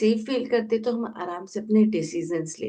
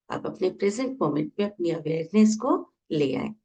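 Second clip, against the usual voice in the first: guided meditation. Acted. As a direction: ease them into each breath, stretching key words. Leads a guided breathing meditation.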